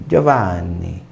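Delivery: "Giovanni" grave, com o nn prolongado; Giovanni italiano. Shown as a demonstration. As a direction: diga "Giovanni" estendendo a sílaba dobrada. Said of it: "eu tenho que estender a sílaba". ("Giovanni" com consoante dupla italiana)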